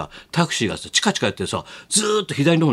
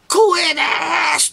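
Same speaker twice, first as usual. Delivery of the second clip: high, drawn-out overwhelmed cry; strained high-pitched exclamation, hammed-up; taxi driver's cry; 13 semitones higher, 3 dB louder, much slower than usual. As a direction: imitate an awestruck driver crying out, stretching the vowels.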